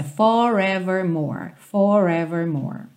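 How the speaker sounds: "Forevermore" with three curled American Rs; standard American accent. Shown as a demonstration. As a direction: roll the Rs of "forevermore" American style. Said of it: "São três na sequência".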